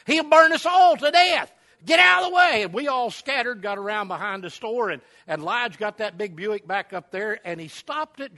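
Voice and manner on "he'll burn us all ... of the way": high, loud alarmed shouts of danger; panicked hollering. Retold or imitated. Arzy warning the crowd the car is coming back, loud high panicked yell. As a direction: holler like the car is bearing down on you. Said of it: "Arzy started hollering".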